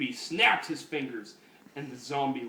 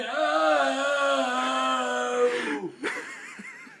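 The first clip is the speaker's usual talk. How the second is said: big laugh, higher and louder; loud laughing shout; 11 semitones higher, 5 dB louder than usual. Real, not acted. Storyteller cracking up after the hippie's no, loud laugh. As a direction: burst out laughing.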